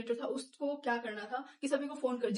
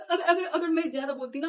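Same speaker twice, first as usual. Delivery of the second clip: laughing through the words, louder; 6 semitones higher, 4 dB louder than usual. Real, not acted. Girl laughing at how she nearly got beaten, laughing. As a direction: giggle while admitting it.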